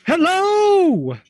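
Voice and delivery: loud, drawn-out high hello; calling out. Acted. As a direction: holler a long greeting, pitch soaring then falling. [name] calls out to the horse.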